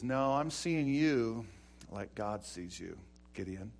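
God quietly lifting up a scared man; tender God. Acted. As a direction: speak it like a loving father. God speaks tenderly to Gideon.

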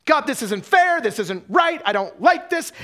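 high, loud mock complaint to God; acted angry outburst, punching each objection; hopping mad. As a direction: shout the protest as if fed up. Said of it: "We get hopping mad".

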